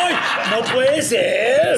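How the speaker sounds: no puede ser, muy lento entre risas; estíralo incrédulo y riendo; sorpresa riendo. Real, not acted. Reacción a la selfie. (incredulidad lenta y risueña)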